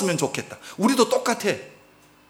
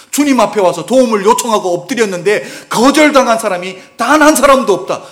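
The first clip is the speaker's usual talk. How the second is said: loud, rising sermon declaration; preacher driving the point home to the congregation, loud and building; preaching at full force; 3 semitones higher, 7 dB louder than usual. Acted. Pastor telling the congregation nobody is refused, full force.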